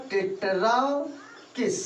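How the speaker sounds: Tetrakis stretched slowly, pausing before किस; drawn-out term. Shown as a demonstration. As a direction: stretch tetra long, then land on किस. Teacher repeats term for pupils. (Tetrakis को खींचकर बोलना)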